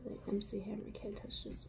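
hushed whispered aside. Pretend. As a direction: whisper the joke under your breath. Whispered joke line.

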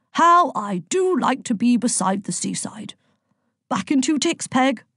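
lightly hammed-up holiday delight; jovial Zeus. A narrator's reading. Zeus arriving at the seaside in Brighton, jovial.

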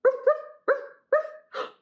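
high, yappy little barks; short, high-pitched mock barks; dog barking. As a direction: imitate a small dog yapping. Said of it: "the banana dog goes".

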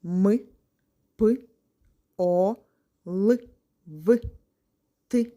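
each sound called out loud and separate; letter sounds called out with pauses, like a teacher leading a class. Shown as a demonstration. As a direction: call out each sound clearly, one at a time. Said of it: "разом назвемо звуки".

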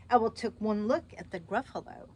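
ordinary delivery

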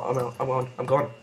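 normal talking voice

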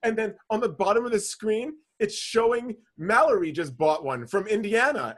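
usual speaking voice

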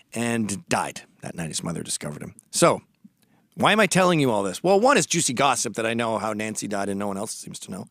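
usual speaking voice

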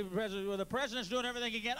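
normal talking voice

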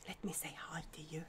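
breathy whispered hello to the chat. Real, not acted. Streamer whispering to her viewers, soft whisper. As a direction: hush it like a secret.

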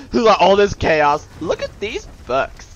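slow, emphatic high exclamation; mock exclaiming. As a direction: exclaim in mock amazement at the chaos. Joking about books amid chaos.

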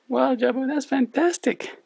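squeaky, high cartoon puppet sidekick. Puppet co-host Lani speaks.

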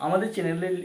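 normal talking voice

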